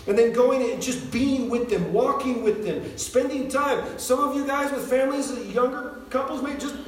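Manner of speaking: high, urgent exhortation; impassioned preaching. Real, not acted. Preacher urging older believers to disciple others, impassioned preaching. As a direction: drive each point home to the congregation. Exhorts the congregation at full force.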